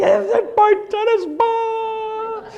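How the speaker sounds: very high, slow squeaky dog voice; a dog perking up at its tennis ball; eager little dog voice. Pretend.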